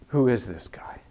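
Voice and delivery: soft anxious whisper; whispered worry. Retold or imitated. Rider eyeing the stranger, whispering her fear. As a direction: breathe it as a worried secret thought.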